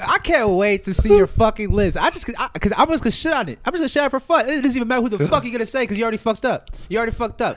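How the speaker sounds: high, loud gleeful taunt; excited taunting. Real, not acted. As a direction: crow over him, teasing. Teasing rant, much higher, louder.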